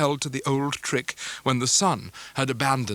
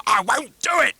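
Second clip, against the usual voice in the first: defiant oath; 11 semitones higher, 6 dB louder than usual. Acted. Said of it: "said Uriah with an oath".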